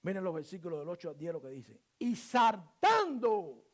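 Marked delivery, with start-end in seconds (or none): 2.0-3.6 loud, drawn-out proclamation; slow, booming pulpit delivery; emphatic preaching. Real, not acted. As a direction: stretch the words out loudly, like a preacher reading scripture. Preaches at full force.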